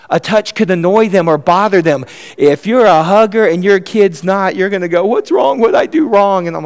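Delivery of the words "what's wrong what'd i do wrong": high-pitched, fretful mock parent; mock worried parent. Hugger parent to a child who pulls away, mock worried. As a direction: plead as a puzzled, rebuffed hugger. Acted hurt parent after refused hug.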